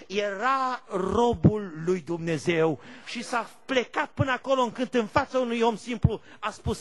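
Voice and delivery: usual speaking voice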